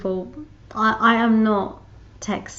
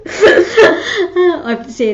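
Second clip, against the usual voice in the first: laughing; 11 semitones higher, 10 dB louder than usual. Real, not acted. Laughing at own story.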